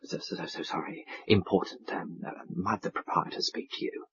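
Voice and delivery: hushed apologetic whisper. A narrator's reading. Waiter whispers to Mister Audley, urgent and apologetic. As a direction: whisper it urgently into his ear. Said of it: "was whispering in his ear".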